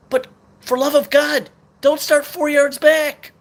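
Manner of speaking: pleading rage at the coach, building up; ranting. Real, not acted. Fan ranting at play call.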